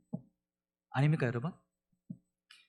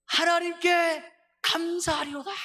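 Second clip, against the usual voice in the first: much higher and slow, ringing thanks; joyful exclamation; 13 semitones higher, slower than usual. Retold or imitated. Pastor voicing Paul's sudden burst of thanks to God. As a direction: shout the thanks in sudden joy. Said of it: "이렇게 막 외치거든요".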